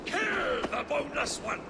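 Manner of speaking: high-pitched, furious kill order; shouted battle command. Acted. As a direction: shout for the enemy's death across a fight. King orders killing in battle.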